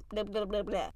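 throwaway filler. Real, not acted. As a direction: mumble filler for a forgotten line. Forgot the line, fills in.

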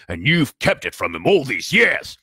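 loud, rising angry accusation; rage. A narrator's reading. Giant accuses the uncle of hiding the letter, raging. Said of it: "his every syllable trembled with rage".

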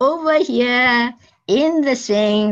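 loud, high call from far off. Acted. Drunk stranger calling from the swing, loud high call. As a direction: shout it out across the yard.

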